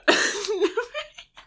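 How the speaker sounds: squealing laughter, can't stop; high helpless laugh. Real, not acted. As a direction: laugh hard and high, out of control. Laughing fit continues.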